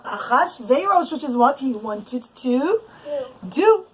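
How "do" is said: ordinary delivery, nothing marked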